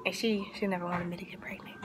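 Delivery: fast hushed whisper. Real, not acted. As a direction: drop to a secret whisper so she can't hear. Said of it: "whispered aside about sister".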